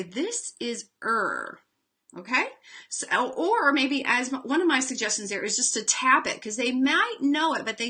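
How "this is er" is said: slow, deliberate "This is er."; slow teacher prompt. Shown as a demonstration. Teacher telling a student what the spelling says. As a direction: point it out calmly and slowly. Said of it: "and say".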